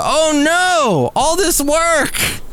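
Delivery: high, slow wail of dismay; high, slow, exaggerated groan; mock dismay. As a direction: moan it like a deflated crowd. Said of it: "Wouldn't there be a bunch of".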